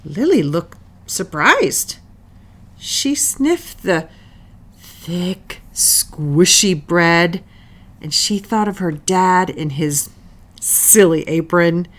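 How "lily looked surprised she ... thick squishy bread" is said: ordinary delivery, nothing marked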